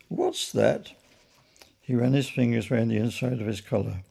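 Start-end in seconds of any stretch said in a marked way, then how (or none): 0.1-0.9 higher, disbelieving second repeat; exasperated, higher-pitched query; incredulous echo. A narrator's reading. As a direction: echo the question, barely believing it. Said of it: "repeated the policeman".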